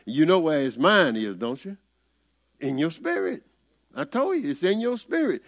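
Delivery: ordinary talk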